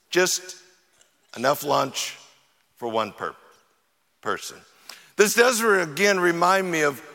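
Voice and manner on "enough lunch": usual speaking voice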